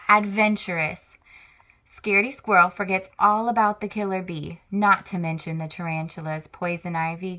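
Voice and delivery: ordinary delivery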